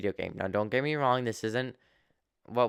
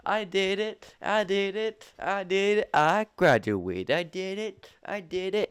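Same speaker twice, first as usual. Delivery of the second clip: excited; 10 semitones higher, 4 dB louder than usual. Retold or imitated. Celebrating graduation to mom.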